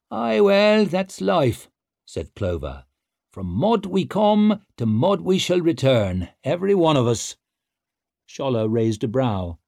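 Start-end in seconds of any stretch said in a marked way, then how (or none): 0.1-7.4 wry Clover voice. A narrator's reading. Clover philosophising.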